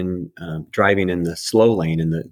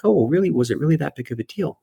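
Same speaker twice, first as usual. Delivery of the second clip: higher, fast, shrugging it off; mildly retold so-what; offhand doubt; 7 semitones higher, faster than usual. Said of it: "that was like".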